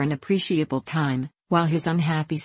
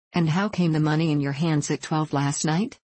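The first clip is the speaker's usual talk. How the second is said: usual speaking voice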